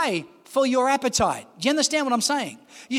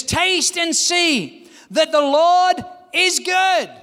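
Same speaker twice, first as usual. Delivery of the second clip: slow, savouring each word of the verse; drawn-out scripture reading; 4 semitones higher, much slower than usual. Acted. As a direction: linger on every word like tasting it. Preacher savours the Psalm verse.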